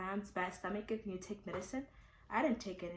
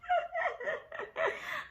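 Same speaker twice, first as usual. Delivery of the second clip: high burst of real laughter. Real, not acted. Sudden laugh mid-story.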